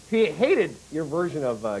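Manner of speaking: ordinary talk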